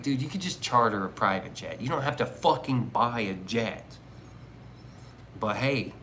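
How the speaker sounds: normal talking voice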